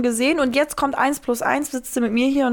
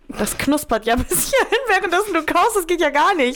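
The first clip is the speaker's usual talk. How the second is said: amused; 7 semitones higher than usual. Real, not acted. Amüsiert über lautes Kauen.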